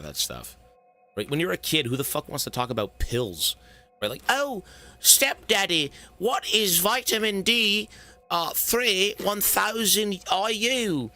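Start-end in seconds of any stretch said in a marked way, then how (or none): 4.3-11.1 put-on Victorian child voice. Streamer mocking his younger self asking his stepdad about vitamins, in a high prissy child voice. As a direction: play a prissy little Victorian kid asking politely. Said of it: "I was a little Victorian child".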